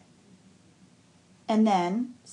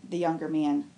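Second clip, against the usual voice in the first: ordinary delivery, nothing marked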